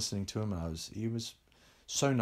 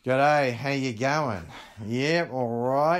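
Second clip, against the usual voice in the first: slowed Australian drawl, louder and higher; slow Australian accent; 4 semitones higher, 6 dB louder, much slower than usual. Shown as a demonstration. Boy copying Australians to be accepted, slow Australian accent. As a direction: stretch it out like a lazy Aussie greeting. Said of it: "I slowed down the way I speak".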